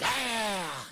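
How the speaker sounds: high, drawn-out mock "aah"; mock exasperated cry. Coach acting out his frustration at unrattled player, mock cry. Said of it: "and I'm like".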